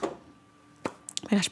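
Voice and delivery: normal talking voice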